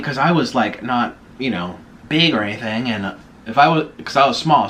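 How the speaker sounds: ordinary talk, nothing marked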